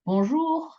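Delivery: bonjour lifted high on a long JOUR; accent on final syllable. Shown as a demonstration. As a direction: pitch up and hold the JOUR of bonjour. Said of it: "intonation on that last bit".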